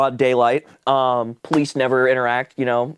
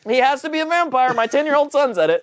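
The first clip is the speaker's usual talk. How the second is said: high-pitched mock parental conviction; mock-credulous parents; 12 semitones higher than usual. Parents backing their ten-year-old son's claim, mock-convinced. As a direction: imitate parents leaping to a silly conclusion.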